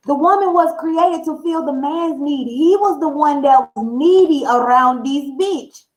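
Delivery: ordinary talk, nothing marked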